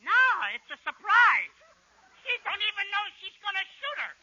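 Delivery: lightly voiced kid; put-on small-boy voice; gleeful little boy. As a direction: play a child sharing a secret. Costello plays little brother.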